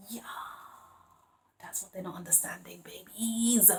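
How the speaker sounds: hushed, voiceless aside; whispering. Real, not acted. Reader confiding to viewers what they're not understanding, whispered. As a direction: let it drop to a whisper.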